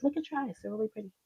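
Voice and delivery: high, soft little inner voice; internal self-talk. Retold or imitated. Woman to herself in the mirror, soft admiring discovery. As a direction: marvel quietly at your own reflection. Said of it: "it was, like, this internal".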